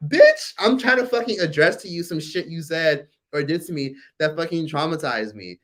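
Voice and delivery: imitating telling off a gaslighting parent; mock exasperated outburst. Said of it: "It's like".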